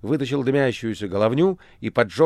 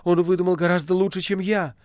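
much higher, louder rapturous exclamation; ecstatic cry; 10 semitones higher, 4 dB louder than usual. A narrator's reading. Margarita echoing the master, rapturously crying out. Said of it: "кричала она мастеру".

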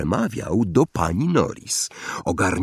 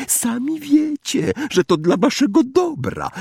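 high syrupy fake concern; mock innocence. A narrator's reading. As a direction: pretend concern, dripping fake kindness. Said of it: "niewinnym głosem".

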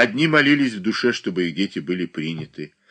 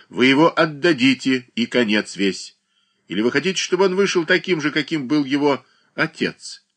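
louder, firm reproach; weighty, firm; 6 semitones higher, 4 dB louder than usual. A narrator's reading. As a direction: press the mother with authority. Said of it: "внушительно произнёс Данила Захарыч".

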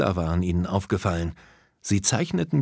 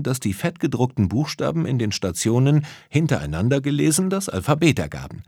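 plain reading voice

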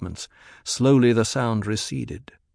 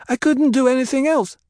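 very high, louder character voice; Alia's voice; 14 semitones higher, 5 dB louder than usual. A narrator's reading. Alia explaining herself to the women, high-pitched character voice. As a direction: pitch it way up, insistent. Child figure Alia answers in story.

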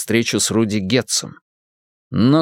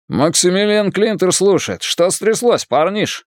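put-on higher, thick Irish accent on the phone; 8 semitones higher than usual. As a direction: lay the Irish accent on full. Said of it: "Ирландский акцент работал на всю катушку".

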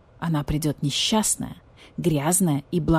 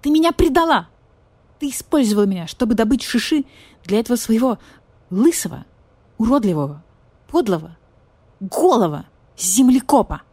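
furious; 4 semitones higher, 4 dB louder than usual. Acted. Furious accusation of betrayal.

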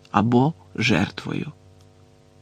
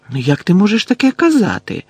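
raised, incredulous questioning pitch; astonished; 7 semitones higher, 4 dB louder than usual. A narrator's reading. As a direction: protest in surprise, voice climbing high. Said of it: "здивувалася Герміона".

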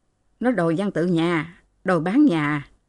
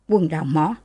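plain reading voice

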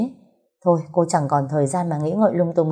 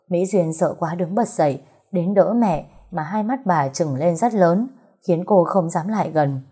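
ordinary delivery, nothing marked

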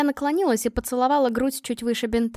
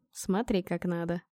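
very soft, low instruction; intimate murmur; 4 semitones lower, 9 dB quieter than usual. A narrator's reading. Man guides the inexperienced girl, intimate murmur. Lover gently shows her how.

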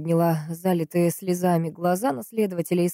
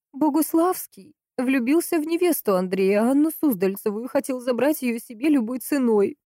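higher woman's voice, insistent; pitched-up, pressing accusation from the sister; Valentina, urgent accuser; 7 semitones higher than usual. Acted. Sister accuses the friend of coveting the bride, urgent.

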